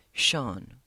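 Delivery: ordinary delivery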